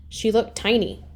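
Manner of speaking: ordinary delivery, nothing marked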